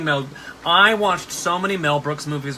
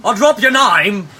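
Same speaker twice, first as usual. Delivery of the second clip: loud, high, stretched mock Cockney; hammed-up Cockney, slow and belted; exaggerated Cockney street cry; Cockney accent; 6 semitones higher, 10 dB louder than usual. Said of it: "some offensive Cockney accents".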